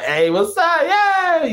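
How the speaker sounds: high-pitched goofy TV greeting; crazy sitcom-style hello; goofy craziness. Retold or imitated. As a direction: ham up a silly, excited cheer. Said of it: "all that craziness".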